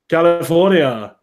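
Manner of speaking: put-on slow Austrian-accented word; Arnold. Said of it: "I also got the Arnold California down".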